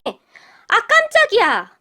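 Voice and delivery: high, loud startled cry, drawn out at the end; startled yelp. A narrator's reading. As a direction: jump out of your skin and protest. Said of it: "깜짝 놀란 도련님이".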